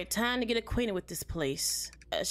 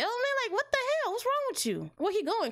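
high indignant; 12 semitones higher than usual. Real, not acted. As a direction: voice far up, exasperated. After being scared by agent.

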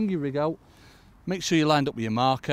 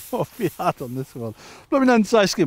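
laugh, then burst out louder; laughing, rising into a high exclamation; amused mock complaint; 8 semitones higher, 9 dB louder than usual. Real, not acted. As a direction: crow it through amusement. Laughs at catching another undersized fish.

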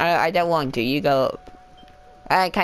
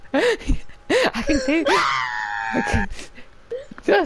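startled cry; 12 semitones higher, much slower than usual. Real, not acted. Startled in game.

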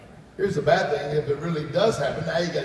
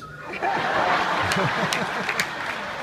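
unvoiced high laugh; silent wheezing laugh; 7 semitones higher than usual. Real, not acted. Pastor cracking up at his own story: wheezy laugh. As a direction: let the laugh come out as air. Laughing after the dream-book joke.

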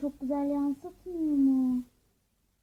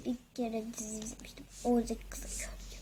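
whispering; 4 semitones lower, 9 dB quieter, faster than usual. Acted. Mother whispers aside.

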